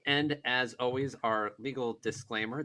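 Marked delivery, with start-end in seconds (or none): none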